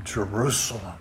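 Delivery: quiet lament. Retold or imitated. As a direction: let the repeated Jerusalem sink into a mournful hush. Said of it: "he declares a lament".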